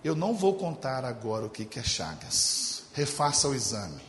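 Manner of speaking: doctor's grave voice. Retold or imitated. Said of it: "falou assim".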